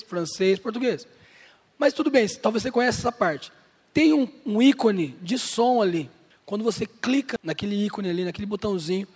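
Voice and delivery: ordinary talk, nothing marked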